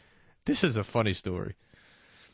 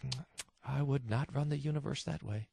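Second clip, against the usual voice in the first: smug disapproval; 9 dB quieter than usual. Retold or imitated. Mocking a disappointed atheist.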